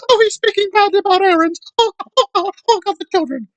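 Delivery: very high, fast mock-panicked wail; mock traumatized woman. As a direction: ham up fake trauma. Mocking woman claiming trauma.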